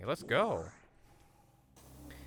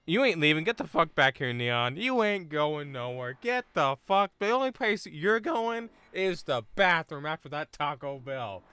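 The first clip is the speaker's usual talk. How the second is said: mock threat; 6 semitones higher, 6 dB louder, slower than usual.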